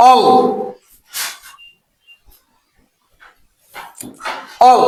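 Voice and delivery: suffix অল as one isolated syllable; pronunciation model. Shown as a demonstration. Teacher asks how the suffix sounds, অল pronounced alone. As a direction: sound out অল, pause long, repeat it. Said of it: "এটার উচ্চারণ কি বলতো?".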